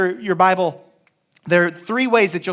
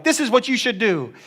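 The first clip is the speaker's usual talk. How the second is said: higher, imitated telling-off; mock bossy; 4 semitones higher than usual. Believers instructing Jesus their master, mock bossy. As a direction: boss him about in a raised pitch. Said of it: "we're like".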